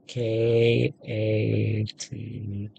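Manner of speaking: ordinary talk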